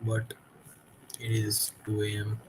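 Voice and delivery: sleepy, yawning through words; tired voice at two a.m. Real, not acted. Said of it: "I do apologize for yawning".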